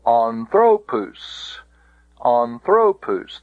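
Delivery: "anthropus" as an-THRO-POOS, drawn out; stress on penult. Shown as a demonstration. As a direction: punch the middle of "anthropus", long final pus. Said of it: "can only go to the".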